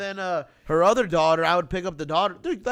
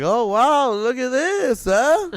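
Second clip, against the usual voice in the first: mock impressed; 4 semitones higher, 7 dB louder, much slower than usual. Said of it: "I was like".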